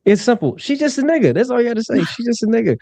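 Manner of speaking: very high comic squeak of a voice; high-pitched mock-simple explaining; put-on high voice. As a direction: make the point in falsetto.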